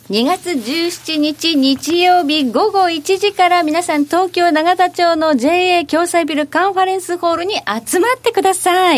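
slow, deliberate event announcement; announcing the public recording carefully to listeners, slow and deliberate. Real, not acted. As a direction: spell out each detail slowly so as not to stumble. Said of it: "噛まずに言えました".

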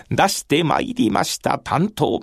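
usual speaking voice

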